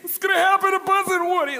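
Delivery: high, loud mock wailing; mock weeping. Preacher recalling Toy Story, hammed-up high wailing. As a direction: wail it like a crying kid. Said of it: "I was, like, weeping".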